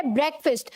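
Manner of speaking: ब्रेकफास्ट said "BREK-fust"; short vowels in both halves of ब्रेकफास्ट; correct pronunciation. Shown as a demonstration. Teacher modelling for students, ब्रेकफास्ट as "BREK-fust". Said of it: "क्या है?".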